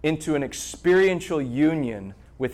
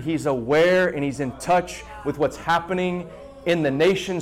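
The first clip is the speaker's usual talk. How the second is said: normal talking voice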